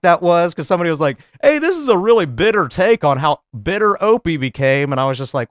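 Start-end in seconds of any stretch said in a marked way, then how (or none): 1.4-4.9 lightly voiced commenter, raised pitch; higher, imitating an online critic; mock commenter. Podcaster voicing a YouTube commenter's complaint, lightly mocking higher voice.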